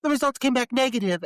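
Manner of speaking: high, hammed-up technician announcement; mock dramatic. Podcaster as lab technician halting the guns, mock dramatic. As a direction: proclaim the results with overdone urgency. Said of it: "this unnecessary dramatic bit".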